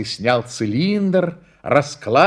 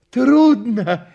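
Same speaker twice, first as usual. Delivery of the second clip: high, louder eager question; curious schoolboy; 8 semitones higher, 5 dB louder than usual. A narrator's reading. Schoolboy asks the man who fired, curious. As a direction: pipe up, keen to know. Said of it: "спросил гимназист".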